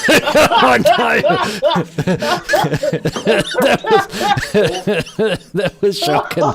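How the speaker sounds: talking through real laughter; giggling while admitting it was bad; laughing at his own bad accent. Real, not acted.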